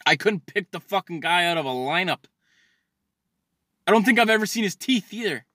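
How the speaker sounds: ordinary talk, nothing marked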